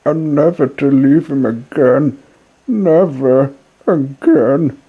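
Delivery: put-on young puppet voice, tearful and desperate; grieving puppet boy. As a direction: beg the dead fairy to wake up. Pinocchio laments dead fairy.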